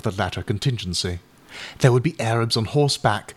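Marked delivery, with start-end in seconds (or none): none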